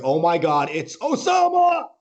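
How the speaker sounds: imitating a friend's piercing shout, last word stretched; screamed greeting. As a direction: holler the name across an airport. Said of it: "he screams my name".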